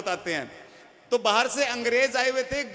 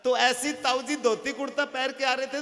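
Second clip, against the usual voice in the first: ordinary talk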